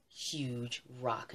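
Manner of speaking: ordinary delivery, nothing marked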